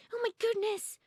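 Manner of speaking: high, loud alarmed bird cry; startled cry. A narrator's reading. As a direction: Robin panicking in the dark. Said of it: "cried Robin".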